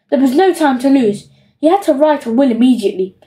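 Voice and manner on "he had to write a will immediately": usual speaking voice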